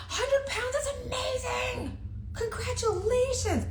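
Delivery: high, slow, syrupy fake-nice praise; exaggerated squeaky congratulations with a laugh; mock gushing congrats. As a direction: gush over it like an over-sweet fan. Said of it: "I was like".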